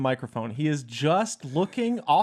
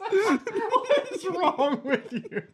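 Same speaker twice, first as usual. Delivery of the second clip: high, slow, laughing "what is wrong with you"; laughing disbelief; much slower than usual. Real, not acted. Co-host laughing at the reader, high and stretched. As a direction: let it squeak out through laughter.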